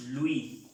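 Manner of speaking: "Louis" said "lu-í", silent S; S final muda. Shown as a demonstration. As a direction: end "Louis" on the vowel i, no S. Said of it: "Y pronunciaremos". ("Louis" pronunciado "luí", sin S final)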